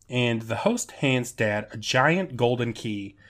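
ordinary talk, nothing marked

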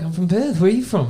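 high, loud, put-on Australian voice; loud hammed-up Australian accent. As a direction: ham up a friendly Australian at high pitch. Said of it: "just come in with an accent".

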